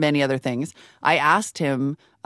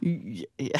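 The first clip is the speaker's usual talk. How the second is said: ordinary talk, nothing marked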